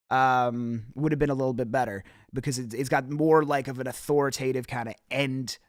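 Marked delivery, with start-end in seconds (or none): none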